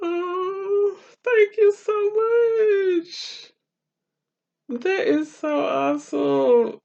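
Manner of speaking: very high, soft, teary voice; tearful thanks. Real, not acted. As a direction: choke up with gratitude. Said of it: "You gonna make me cry".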